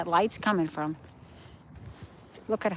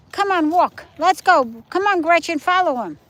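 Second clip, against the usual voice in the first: high, loud calls to the dogs; calling out to the dog; 7 semitones higher, 7 dB louder than usual. Real, not acted. Owner calling her dogs on the walk, high and loud. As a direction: call the dogs over across the sand.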